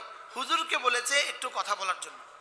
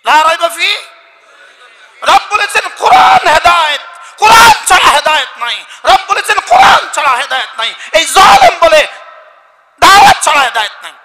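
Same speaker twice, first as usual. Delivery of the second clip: shouted, high-pitched sermon; roaring preacher driving the point in; fiery preaching; 4 semitones higher, 19 dB louder than usual. Real, not acted. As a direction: hammer it home at full volume. Preaches at full force.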